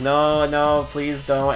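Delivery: mock pleading. Streamer begging offended chatters to stop, mock pleading. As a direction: beg them off in mock distress.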